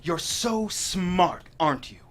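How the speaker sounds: slow, menacing taunt through clenched teeth. Acted. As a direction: sneer each word slowly. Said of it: "Burke's teeth are clenched".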